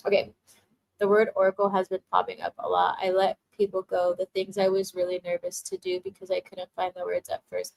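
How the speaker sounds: normal talking voice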